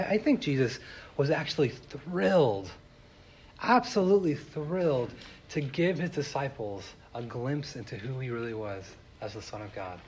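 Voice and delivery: normal talking voice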